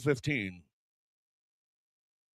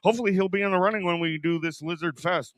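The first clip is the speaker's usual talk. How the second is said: ordinary talk, nothing marked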